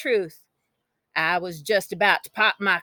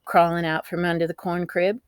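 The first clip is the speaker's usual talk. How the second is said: ordinary delivery, nothing marked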